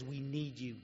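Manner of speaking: soft, low, hushed. Retold or imitated. Old geezer at the kitchen sink, quietly and tenderly. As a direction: let the words land barely above a breath. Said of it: "and says".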